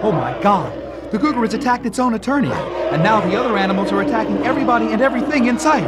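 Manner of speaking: high, loud frantic yelling; panicked shouting. Acted. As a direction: scream as animals attack. Animals attack everyone.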